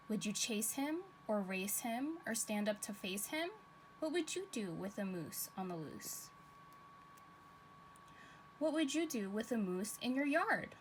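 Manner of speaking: put-on lilting storybook read-aloud for kids; reading to children. A narrator's reading. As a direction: read it like story time for little ones. Teacher reads picture book aloud.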